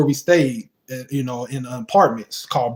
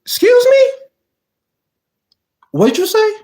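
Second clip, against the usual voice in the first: shocked disbelief. Retold or imitated. To his friend who says it's five thirty, stunned high-pitched disbelief. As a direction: shriek it like you can't believe your ears.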